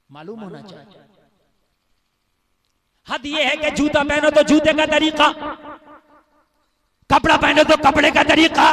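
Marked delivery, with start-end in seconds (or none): 3.1-8.7 high, shouted sermon line; full-force preaching. Real, not acted. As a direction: hurl each example out at the crowd. (ऊँची आवाज़ में ज़ोरदार तक़रीर)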